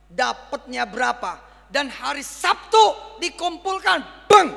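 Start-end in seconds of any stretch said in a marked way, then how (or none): 1.7-4.5 raised, drawn-out preacher's cry; rally the crowd toward the finish; rousing call. Real, not acted. Preacher rallies congregation to collect the money, raised voice.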